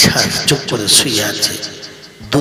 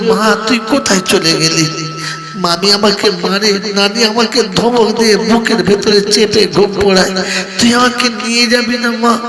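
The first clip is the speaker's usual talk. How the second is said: tearful plea; 6 semitones higher, 3 dB louder than usual. Acted. Daughter's tearful plea to mother.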